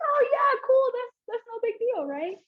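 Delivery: high, airy "sure, no problem"; breezy mock agreement. Answering the pastor's request, high and falsely casual.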